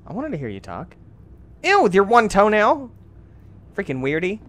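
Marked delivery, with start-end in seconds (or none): 1.6-2.9 high, loud grossed-out jeer; disgusted. Real, not acted. Player mocking the creature's one toenail, disgusted. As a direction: exclaim it high in revulsion.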